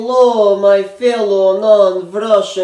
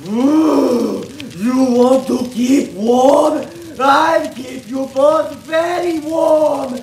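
put-on higher, building to a shout, thick Russian accent; crazed Russian comrade shouting; menacing Russian; 8 semitones higher than usual. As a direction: threaten them gleefully, then charge. Nikolai menacing the pair.

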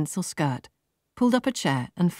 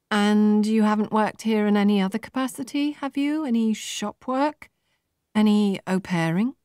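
higher, bouncy professional cheer; a light voicing of an upbeat recruiter; perky young businesswoman; 6 semitones higher than usual. A narrator's reading. Laura telling Marta her scores, perky and high.

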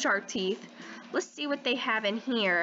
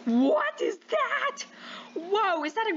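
startled; 9 semitones higher than usual. Real, not acted. New shark appears.

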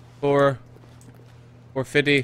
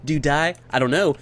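ordinary delivery

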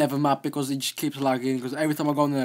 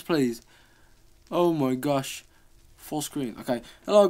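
ordinary talk

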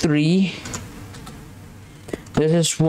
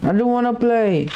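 high, whiny refusal; scared whining; 8 semitones higher than usual. Real, not acted. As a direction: plead not to play, pitched up.

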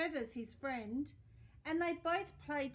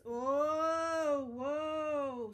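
louder, rising "oh, whoa" of alarm; startled reader; 2 semitones higher, 7 dB louder than usual. Real, not acted. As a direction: react as the pile topples. Reader reacts to toppling pile.

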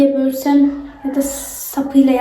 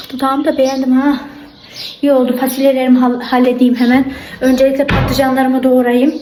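ordinary delivery

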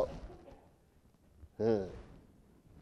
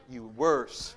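low teasing; 12 semitones lower, 4 dB quieter than usual. Shown as a demonstration. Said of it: "You were worse".

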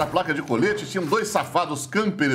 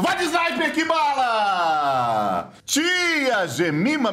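excited shout; 7 semitones higher, much slower than usual. Real, not acted. Hyped sniper kill call.

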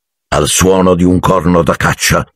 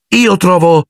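high-pitched hasty opinion; earnest, high boy's voice; blurting boy; 13 semitones higher than usual. A narrator's reading. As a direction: pipe up on cue. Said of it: "snocciolò Harry tutto d'un fiato".

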